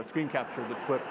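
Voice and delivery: ordinary delivery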